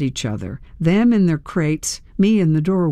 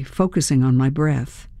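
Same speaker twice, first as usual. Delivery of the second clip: ordinary delivery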